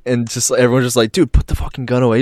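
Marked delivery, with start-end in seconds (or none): 1.1-2.2 retold urgent demand. Acted group demand.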